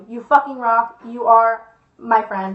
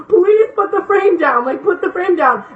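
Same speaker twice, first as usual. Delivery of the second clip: imitated freak-out; 5 semitones higher than usual. Mike begs the girl to drop the frame, freaking out. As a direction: plead as if near tears, mimicking a panicked friend. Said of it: "freaking out".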